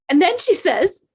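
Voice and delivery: plain reading voice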